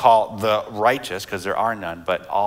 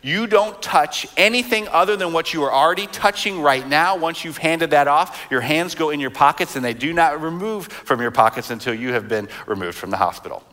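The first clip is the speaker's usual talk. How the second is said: higher-pitched mock lecture to kids, easing down at the end; imitated stern parent; 6 semitones higher than usual. Wife to kids walking into the hospital, imitated strict lecture. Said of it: "gives this speech to our kids".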